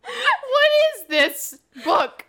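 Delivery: high, slow, wailed through laughter; laughing disbelief. Real, not acted. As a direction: cry out in helpless amused disbelief. Cracking up over the book.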